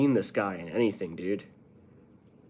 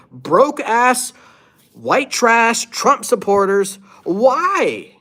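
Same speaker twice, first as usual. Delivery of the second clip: heated rant; 8 semitones higher, 5 dB louder than usual. Real, not acted. Ranting at Trump supporters.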